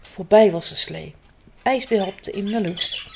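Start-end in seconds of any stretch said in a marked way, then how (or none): none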